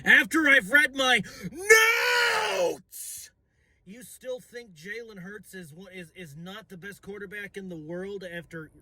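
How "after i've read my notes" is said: high, straining yell; cracking shout. Acted. Fan screaming about his notes at critics. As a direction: push the voice until it cracks.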